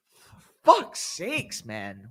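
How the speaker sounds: real fed-up cussing; exasperated curse. Real, not acted. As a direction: drag it out in frustration. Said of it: "I had to cuss that out".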